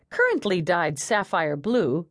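ordinary delivery

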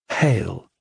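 ordinary delivery, nothing marked

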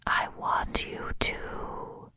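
very soft, slow whisper in the ear; whisper in your ear. Acted. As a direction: barely breathe it, slowly, right at the ear. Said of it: "as I whisper in your ear".

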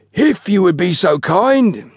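high, slow, pompous courtesy; lofty. A narrator's reading. Station master addresses his porters, lofty. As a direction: stretch it with lofty politeness. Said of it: "he said in a lofty manner".

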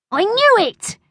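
very high schoolboy glee; high-pitched childish excitement; excited. A narrator's reading. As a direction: burst out in triumph. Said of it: "said Bertie excitedly".

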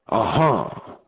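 understated relieved "ah-ha"; relief. A narrator's reading. Gang leader hears the awaited horse, mild relief. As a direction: let it out with a touch of relief. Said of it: "exclaimed Snake in relief".

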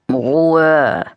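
sleepy grumpy mumble; grumbling. A narrator's reading. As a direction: grumble from half asleep. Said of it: "brummelte Max". (verschlafenes Brummeln)

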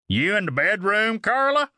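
high call, rising toward the end. A narrator's reading. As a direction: call out as you come nearer. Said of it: "growing louder down the hallway".

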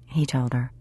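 ordinary delivery, nothing marked

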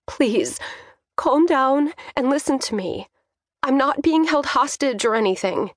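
high-pitched daughter's appeal; pleading daughter. A narrator's reading. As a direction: beg an overwrought father to listen. Daughter holding back tears, pleading.

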